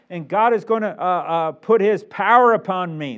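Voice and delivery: loud, high, emphatic pulpit voice; impassioned preaching. Acted. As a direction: preach it with rising conviction.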